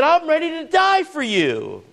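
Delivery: high-pitched, impassioned declaration; bold vow. Retold or imitated. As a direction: swear it fervently, pitch soaring. Acts out Peter's bold vow.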